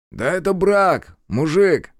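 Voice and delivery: high, slow, drawn-out banter; high-pitched teasing call; calling out jovially. A narrator's reading. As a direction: holler a joke from the truck. Acquaintance calls out from a truck.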